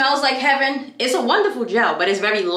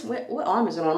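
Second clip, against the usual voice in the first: usual speaking voice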